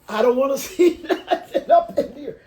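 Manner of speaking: loud, high, laughing protest; laughing mock refusal. Sibling clowning about the body holding place, laughing refusal. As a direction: cry out in mock fright through laughter.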